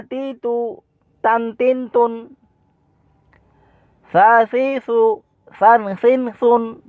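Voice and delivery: high, level-toned syllable chant; quick recitation drill. Shown as a demonstration. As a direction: recite the short syllables for pupils to copy. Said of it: "হারাকাতের উচ্চারণ তাড়াতাড়ি পড়তে হয়".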